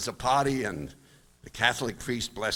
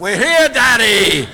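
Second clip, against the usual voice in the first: loud, high crowd yelling back; crowd shout; 10 semitones higher, 12 dB louder, slower than usual. Retold or imitated. Balcony of kids answering their father, shouted.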